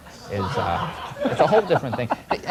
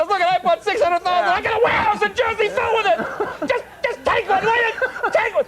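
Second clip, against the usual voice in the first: mock crying plea. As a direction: beg them through fake tears. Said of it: "he starts crying".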